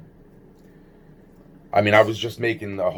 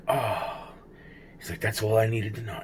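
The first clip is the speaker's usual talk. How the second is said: quiet reply; 8 dB quieter than usual. Retold or imitated. Alfredo's quiet reply, retold.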